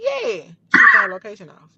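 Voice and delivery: leaping high, then loud; outraged disbelief; indignant shock. Retold or imitated. Location switched off.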